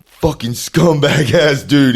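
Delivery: loud, high, drawn-out cursing; furious uncle. Retold or imitated. Uncle cursing out his nephew over speakerphone, furious. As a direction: imitate an angry uncle, stretching the insult.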